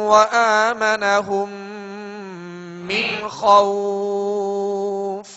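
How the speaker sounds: slow, drawn-out tajweed recitation; measured chant, every elongation held; Quran recitation. Shown as a demonstration. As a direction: recite the verse with long, sustained vowels.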